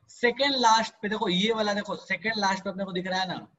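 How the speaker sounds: usual speaking voice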